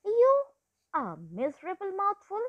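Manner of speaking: lion sneering at a tiny rabbit meal, angry; angry scorn. A narrator's reading. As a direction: spit the insult at the little rabbit. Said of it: "Leo was full of anger and he said".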